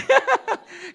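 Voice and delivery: sudden high laugh at his own joke; high burst of laughter. Real, not acted. Joke about nail bars.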